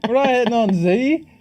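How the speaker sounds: rally to Zac's defence; excited protest. Real, not acted. Defending Zac, laughing.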